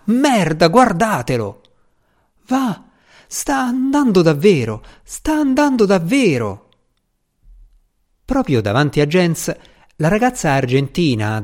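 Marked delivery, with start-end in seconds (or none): 0.0-6.7 high-pitched, thrilled cheering-on, kept short of a shout; excited crowd exclamations. A narrator's reading. Spectators watching the shuttle finally lift off, excited exclamations. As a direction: voice an amazed onlooker, pitch leaping up. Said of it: "parlavano a gran voce".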